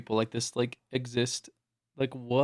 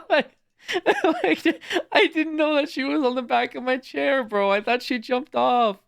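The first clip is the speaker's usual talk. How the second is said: pitched way up, laughing while talking; giggly, barely holding it together; 13 semitones higher than usual. Real, not acted. Streamer explaining the cat clip to chat, laughing.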